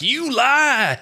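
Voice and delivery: high squeaky yell of an angry man; mock fighter. Friend imitating the fighter calling him a liar in a high squeal. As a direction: shriek the accusation in a hammed-up voice. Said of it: "He's like".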